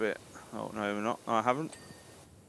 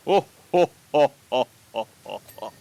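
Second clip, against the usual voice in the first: loud mock panda chuckle of oh oh; panda laugh; 5 dB louder than usual. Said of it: "the panda voice".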